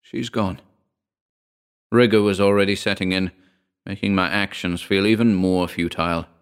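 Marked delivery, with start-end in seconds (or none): 0.0-0.6 quieter, breaking with tears; tearful. A narrator's reading. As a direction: let the grief soften it. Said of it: "tears forming in my eyes".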